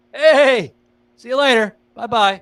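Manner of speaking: high, loud taunting goodbye; hammed-up gloating farewell; mocking send-off. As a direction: wave off a rival in a sing-song jeer. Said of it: "be like".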